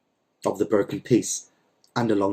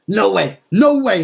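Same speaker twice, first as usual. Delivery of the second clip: high, loud cut-off; loud interruption; 9 semitones higher, 6 dB louder, slower than usual. A narrator's reading. Landowner cutting off the mender, loud and high. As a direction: shout him down. Don Lal interrupting loudly.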